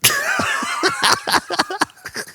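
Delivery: a shrieking giggle; high laugh. Real, not acted. Amused by the own joke, a high laugh.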